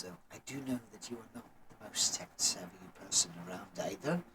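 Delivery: low, hushed priest giving instructions; gently brief a novice, very softly; soft-spoken temple priest. Acted. Priest sends acolyte off to help, low and hushed.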